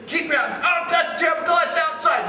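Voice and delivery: very high, loud yell at the umpire; screaming sideline dad. Retold or imitated. Dad in the stands yelling at the umpire, very high and loud. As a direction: shout down a bad call. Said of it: "And screaming".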